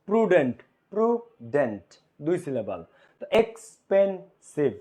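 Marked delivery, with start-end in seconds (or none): 0.1-1.9 প্রুডেন্ট slow, in two beats; syllable demo. Shown as a demonstration. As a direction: sound out প্রুডেন্ট in two parts. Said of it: "তুমি যখন বলতেছো যে". (প্রুডেন্ট দুই ভাগে উচ্চারণ)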